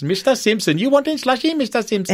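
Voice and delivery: high-pitched cartoon shopkeeper; sing-song Simpsons shopkeeper impression; Indian shopkeeper voice.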